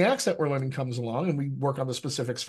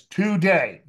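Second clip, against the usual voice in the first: TODAY hit higher and louder; emphatic TODAY; 4 semitones higher, 6 dB louder than usual. Real, not acted. Coach insisting students have it already, emphatic TODAY. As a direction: land hard on "Today" with a falling finish. Driving home the point to class.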